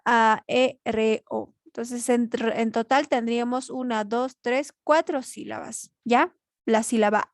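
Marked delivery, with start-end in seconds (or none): 0.0-1.5 "A, E, R, O" as four separate beats, "re" for R; syllable by syllable. Shown as a demonstration. Teacher counting four syllables, "A, E, R, O" said one at a time. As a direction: split aéreo into A, E, re, O with small gaps. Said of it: "además que se separa".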